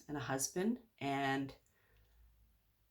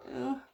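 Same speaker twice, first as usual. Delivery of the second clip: retold groan; 9 semitones higher than usual. Reacting to the offer to participate.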